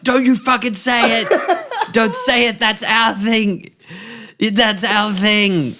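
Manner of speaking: high, loud, slow and laughing through it; hammed-up grief. Grieving partner yelling at paramedics, slow hammed-up anguish. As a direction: overplay the grief, slowing down.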